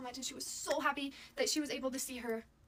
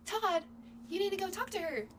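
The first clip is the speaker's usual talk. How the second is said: raised, higher-pitched imitation of a mom; mom urging her son; 8 semitones higher than usual. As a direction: call out to Todd, pushing him to go. Acting out his mom urging Todd.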